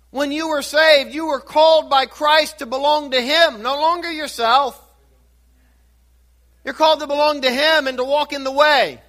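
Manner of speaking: a pastor rising to the climax of his sermon; preaching at full force. Acted. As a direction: build it like a pulpit altar call.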